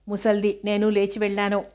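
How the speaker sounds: ordinary delivery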